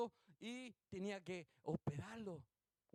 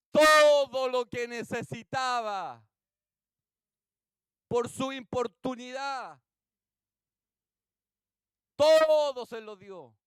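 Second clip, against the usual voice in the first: very loud, slow, stretched words; emphatic preaching; 4 semitones higher, 19 dB louder, slower than usual. Real, not acted. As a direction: proclaim it slowly at full force. Predicador remata la parábola. (prédica a toda voz, lenta y enfática)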